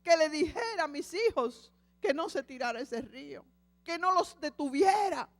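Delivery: father shouting in grief and anger. Acted. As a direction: yell your outrage at the missing signs. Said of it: "empezó a gritar".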